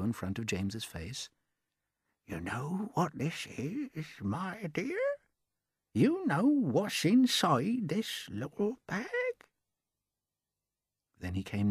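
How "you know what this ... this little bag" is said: put-on secretive old man. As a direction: lure the boy with a secret. Old man tempting James.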